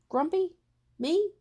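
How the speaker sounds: high, loud, offended "Grumpy? Me?"; indignant disbelief. Acted. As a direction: bristle at the very idea, sharply up. Jim denies being grumpy, indignant.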